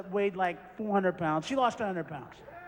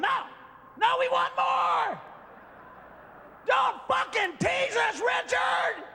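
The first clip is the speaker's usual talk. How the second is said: screaming fans; 9 semitones higher than usual. Acted. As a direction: play a furious fan demanding the sequel. Audience voiced demanding more.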